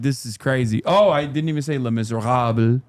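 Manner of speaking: ordinary talk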